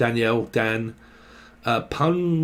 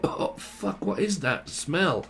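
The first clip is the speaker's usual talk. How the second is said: softer, breathless whispered disgust; hushed disgust; 3 semitones higher, 8 dB quieter, slower than usual. Retold or imitated. Imitating someone smelling something foul, hushed whisper. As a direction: recoil from the stink under your breath.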